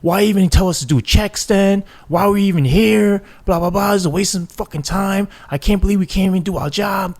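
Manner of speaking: venting like a cop fed up with being told to stand down; high-pitched retold outrage at a wasted shift; pissed-off cop. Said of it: "They get pissed off, livid".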